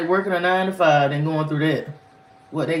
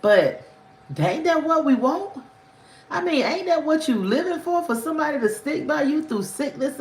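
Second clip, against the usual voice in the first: indignant; 9 semitones higher than usual. Real, not acted. Indignant rhetorical questions.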